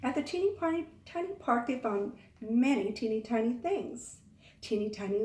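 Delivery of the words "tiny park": usual speaking voice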